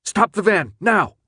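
high, loud shouted order; urgent yell. A narrator's reading. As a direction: shout to halt the driver at once. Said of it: "he yelled".

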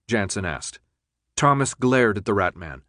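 ordinary delivery, nothing marked

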